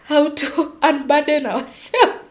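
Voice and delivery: ordinary talk, nothing marked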